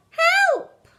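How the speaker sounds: very high loud frightened shriek; shrieked help. A narrator's reading. Terrified sister crying for help, high loud shriek. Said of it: "the other cried out".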